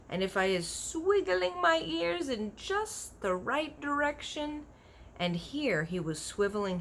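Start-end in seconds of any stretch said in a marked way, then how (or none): 0.1-4.7 giant's voice. A narrator's reading. BFG voiced in a story.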